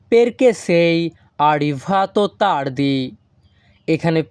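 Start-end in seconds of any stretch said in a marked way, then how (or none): 0.1-3.0 very slow, careful Italian question; slow Italian model. Shown as a demonstration. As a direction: stretch arrivato tardi for learners. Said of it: "এই বাক্যের Italian ভাষা হবে".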